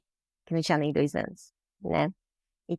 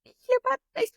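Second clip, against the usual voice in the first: much higher, sobbing mock cry of joy; crying and shouting; slower than usual. Mother shouting and crying on the phone at her daughter's news, much higher voice. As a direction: voice the mother wailing thanks through tears, far higher. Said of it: "Gritando e chorando".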